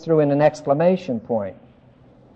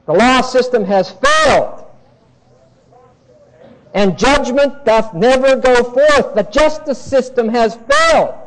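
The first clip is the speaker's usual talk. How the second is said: loud, high, driving sermon voice; fervent preaching; 5 semitones higher, 4 dB louder than usual. Acted. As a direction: preach the outrage hard to the congregation. Preaching at full force.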